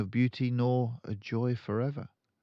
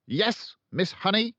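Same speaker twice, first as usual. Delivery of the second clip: loud, high, impatient barked greeting; Trunchbull barking; 12 semitones higher, 5 dB louder than usual. A narrator's reading. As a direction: bark it like she has no time. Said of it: "She either barked or shouted".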